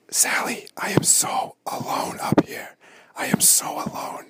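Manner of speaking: forlorn whisper. Pretend. Whispering to Sally about being alone. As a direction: whisper it, pitiful and lonely. Lonely hunter whispers to host.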